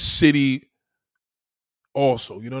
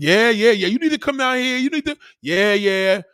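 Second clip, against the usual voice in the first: mock agreement; 7 semitones higher, 6 dB louder than usual. Humouring cousins in the South, mock agreement. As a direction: brush them off with loud, high yeahs. Voicing his brush-off of cousins.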